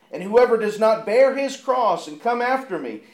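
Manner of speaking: loud, rising preacher's reading; fervent pulpit reading of Luke; scripture read with force. Acted. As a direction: proclaim it to the congregation. Preacher reads scripture aloud.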